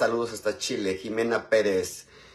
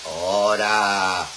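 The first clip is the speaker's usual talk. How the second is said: mock masculine voice; 7 dB louder, much slower than usual. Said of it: "mi voz masculina".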